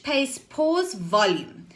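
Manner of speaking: ordinary talk, nothing marked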